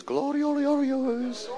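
high slow Gloriorios drawn out like a call; far-off echoing Gloriorios; echoing through valleys. Retold or imitated. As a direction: sing Gloriorios out across the valleys. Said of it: "Then it echoes through all the valleys".

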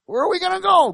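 high, frantic demon squeal; panicked demons. Retold or imitated. Demons freaking out as Jesus steps ashore, squealing. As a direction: whine like trapped demons. And they all started freaking out.

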